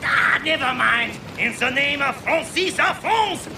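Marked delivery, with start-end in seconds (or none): 1.4-3.5 put-on thick French accent on France. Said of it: "they are laughing at the way you are saying".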